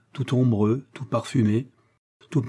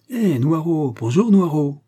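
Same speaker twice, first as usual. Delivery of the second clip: higher, louder playful hail; children calling; 5 semitones higher, 5 dB louder, slower than usual. Acted. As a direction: call out cheerfully to the passing dog.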